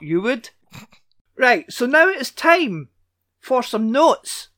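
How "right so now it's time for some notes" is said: announcer intro. Acted. Announcing the notes segment.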